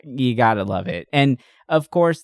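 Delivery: ordinary talk, nothing marked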